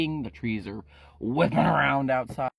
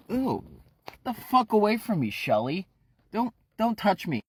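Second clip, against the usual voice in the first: disgusted Ephraim; 9 semitones higher than usual. Acted. Ephraim pushing clingy Shelly away, disgusted shout. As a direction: shove him off with your voice.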